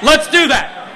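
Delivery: high, forceful rallying cry; fired-up preaching. Real, not acted. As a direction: rouse the crowd to its feet. Rallies the congregation.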